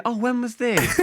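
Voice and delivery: mock innocent prankster; innocent troll. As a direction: play dumb to confuse them. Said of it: "then say".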